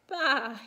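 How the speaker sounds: sudden loud bleat; lamb's cry. A narrator's reading. Little lamb scraped by a branch, loud bleating cry. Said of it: "he cried".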